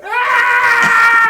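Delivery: very high, loud fake wail; boy faking a cry for his sister behind the door; fake cry scream. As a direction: let out a staged pained yell. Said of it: "you cry".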